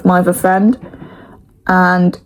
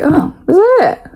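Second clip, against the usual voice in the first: high incredulous self-mockery; sarcastic surprised whine; mock disbelief; 12 semitones higher than usual. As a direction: pretend shock at few tears. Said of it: "I was like".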